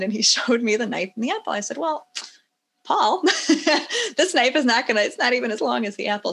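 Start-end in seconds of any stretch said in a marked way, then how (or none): 1.8-6.3 higher, laughing retold reproach to a child; amused chiding. Mother to son about his knife choice, laughing chiding. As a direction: point out the obvious through a laugh.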